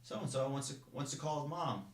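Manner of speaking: mock the recruit in front of the group; mocking drill instructor. Drill instructor taunting the recruit before the formation, mocking. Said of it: "He's like".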